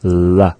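La with the tongue held in the L position; La as a plain L on the ridge. Shown as a demonstration. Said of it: "place the tongue to make that sound".

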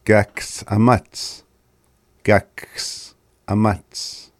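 very slow, careful syllables; slow repeat for the class; slow demo. Shown as a demonstration. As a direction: stretch each sound out for learners. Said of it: "we're saying it a bit slow".

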